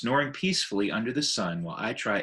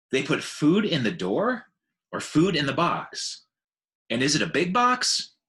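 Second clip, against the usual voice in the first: put-on higher, louder rapid child's questions; excited little elephant; 6 semitones higher, 5 dB louder than usual. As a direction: bombard him like an excited child. Ruby peppers Ivan with questions.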